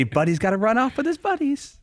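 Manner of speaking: sing-song like a cheerful elf; imitating Will as Buddy the Elf. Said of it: "but he would say".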